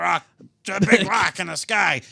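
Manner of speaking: lightly voiced cartoon Fred, boastful; Fred Flintstone bragging. Fred mocks Barney for being henpecked, boastful cartoon voice. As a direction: swagger it like a cartoon caveman.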